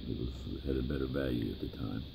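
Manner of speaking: ordinary delivery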